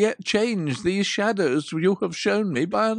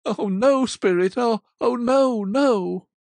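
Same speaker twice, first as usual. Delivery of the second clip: loud, higher wailing "oh, no"; anguished cry; 4 semitones higher, 8 dB louder than usual. Acted. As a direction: Scrooge begging at his own grave. Scrooge despairs at his grave.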